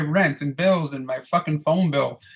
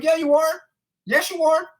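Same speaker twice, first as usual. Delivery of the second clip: much higher teasing sing-song; sassy insisting; 13 semitones higher than usual. Retold or imitated. Janitor teasing his boss, in a high sing-song voice. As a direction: needle her in a high, playful voice. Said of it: "I'm like".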